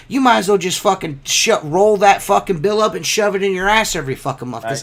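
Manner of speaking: worked-up retold refusal; retold angry tirade. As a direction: re-enact a heated telling-off. Retold telling-off of the caller.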